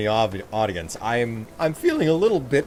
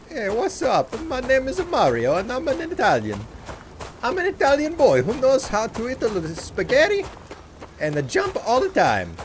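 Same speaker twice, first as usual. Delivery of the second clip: high-pitched cartoon Mario with hammed-up Italian accent; bad Italian accent; 12 semitones higher than usual. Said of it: "it was a bad accent".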